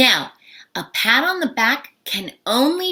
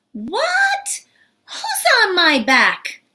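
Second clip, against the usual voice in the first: high, stretched, baffled question; playfully baffled, pitch jumping up; mock surprise; 10 semitones higher, slower than usual. Teacher playing a puzzled student hearing the idiom, mock surprise.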